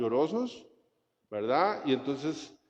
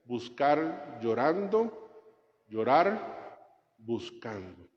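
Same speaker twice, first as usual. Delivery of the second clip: slow solemn maxim; much slower than usual. Acted. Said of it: "Dice un padre de la iglesia".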